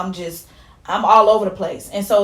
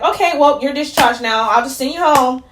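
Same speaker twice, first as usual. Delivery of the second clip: raised, brisk imitation of hospital staff; mock unsympathetic; 4 semitones higher, 4 dB louder than usual. Mimicking staff discharging her without empathy, mock unsympathetic. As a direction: imitate a careless send-off, higher and louder. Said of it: "none of them empathized with me at all".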